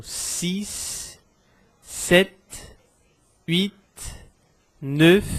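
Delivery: "six, sept, huit, neuf", each final consonant sounded, words well apart; one number at a time, landing on the last s, t, t, f; consonnes finales prononcées. Shown as a demonstration. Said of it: "eu pronuncio as consoantes finais".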